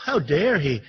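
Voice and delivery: mocking her outrage, pitched low with a laugh; mock indignation. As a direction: sneer out a fake "how dare he". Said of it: "she acted so indignant".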